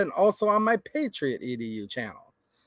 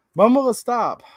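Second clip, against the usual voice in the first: louder, higher cry of frustration; exasperated outcry; 6 semitones higher, 6 dB louder than usual. Real, not acted. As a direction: throw the question up in despair. Exasperated outcry ending a rant.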